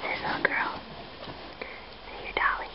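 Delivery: soft unvoiced whisper; hushed whispered question. Real, not acted. Parent whispering to baby.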